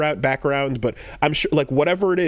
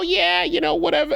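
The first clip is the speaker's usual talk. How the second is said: much higher, airy brush-off; mock dismissive. Player brushing off the confused host, much higher and airy. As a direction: toss it off in a squeaky shrug. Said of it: "And you're just like".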